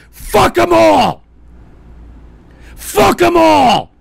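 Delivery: slow, high bellow, each word drawn out; furious shout. Real, not acted. Host curses rival nations, furious shouting. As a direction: shout it loud with real rage.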